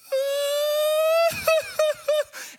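high loud mock wail; a loud imitated sob burst; retold wail. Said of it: "he begins weeping".

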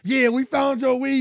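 high, drawn-out loud announcement; loud mock accusation. Retold film boyfriend accuses Jody, loud high taunt. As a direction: call him out slowly and loudly. Retelling film's accusation scene.

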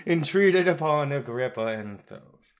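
plain reading voice

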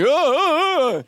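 high, strained squint at the bill; hammed-up can't-read-it whine; squinting friend.

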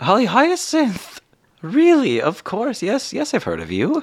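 high-pitched, excited recognition; thrilled hostess, voice shooting up; starstruck. Acted. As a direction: gush at meeting someone famous. Mother recognises the famous guest.

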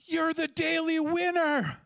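high, slow, excited announcement; hammed-up cashier exclaiming you won; cashier announcing. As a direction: proclaim it like a thrilled cashier.

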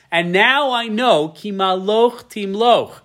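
lightly voiced Saul, slow; Saul conceding. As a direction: let the king admit defeat. Voicing Saul's realization.

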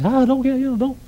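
high, pleading demon voices; mock frightened devils begging; panicked demons. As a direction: cry out like a cornered spirit. Demons crying out to Jesus.

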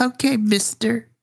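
put-on high-pitched small child's voice; little boy. Game master voices the little boy.

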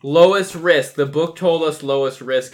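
loud, high announcement; loud proclamation. Real, not acted. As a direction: proclaim it to the whole table. Calls it out to everybody.

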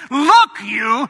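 loud shout on "Look", dropping on "you"; a teenage son mouthing off; rebellious teen shouting at dad; defiant "Look, you". Retold or imitated. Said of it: "what would you do about that".

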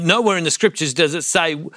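ordinary talk

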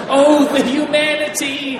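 loud, high melodramatic wail; over-the-top grief, high and loud; mock weeping wail. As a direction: cry out in hammed-up despair. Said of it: "she just starts weeping".

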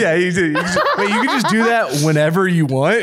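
talking through laughter; laughing. Real, not acted. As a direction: giggle through the words.

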